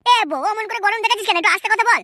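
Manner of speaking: ordinary delivery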